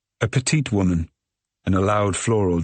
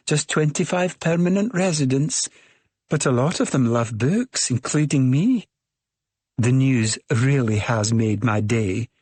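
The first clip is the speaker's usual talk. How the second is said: put-on higher, bubbly thick Scottish accent; 5 semitones higher than usual. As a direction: gush with a thick Scottish lilt. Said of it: "she says in a thick Scottish accent".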